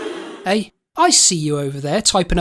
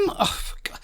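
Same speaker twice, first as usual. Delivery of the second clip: dismayed whisper; 10 dB quieter than usual. Acted. Groans at the binman letter.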